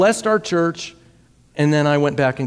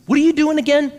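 eager question; 12 semitones higher, 4 dB louder, faster than usual. Retold or imitated. Pastor retells prophet's question.

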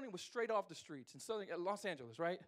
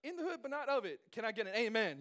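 put-on playful church kid; 6 semitones higher than usual. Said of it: "I'm like".

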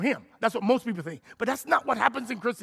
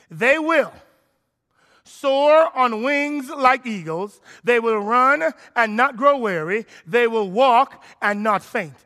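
loud, slow, proclaiming the verse; fervent scripture reading; 3 semitones higher, 10 dB louder, slower than usual. Acted. Preacher proclaiming Isaiah's verse aloud, slow and loud. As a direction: proclaim the promise slowly.

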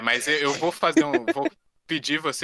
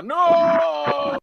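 high, loud despairing scream of no; anguished shout; 5 dB louder than usual. Retold or imitated. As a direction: cry out in horror. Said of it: "ele só ia gritar".

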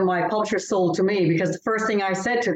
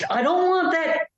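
higher, reluctant "I don't want that"; inner objection; 10 semitones higher than usual. Retold or imitated. Author recalling her reaction to the title, reluctant. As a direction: voice your own doubtful thought, pitched up. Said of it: "And I'm thinking".

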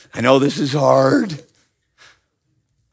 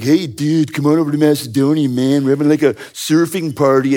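easygoing beach-bum voice, a little fast; California surfer dude. Retold or imitated. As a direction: play the guy as a chill California surfer inviting a friend. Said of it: "no more California jokes".

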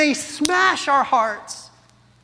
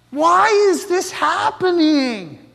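slow, pleading, raised cry of someone in pain; anguished cry; 3 semitones higher, 3 dB louder, slower than usual. Retold or imitated. Woman in pain asking God why, anguished slow plea. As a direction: ask it like a woman clutching her aching head.

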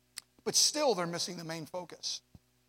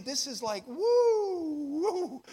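mock amazement; 8 semitones higher, 4 dB louder, much slower than usual. Preacher acts his own amazement.

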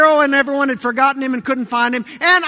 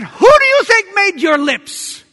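loud, high rhetorical challenge; forceful rebuke; 7 semitones higher, 6 dB louder than usual. Acted. God answers Moses' excuse, loud rebuke. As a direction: fire the question back hard.

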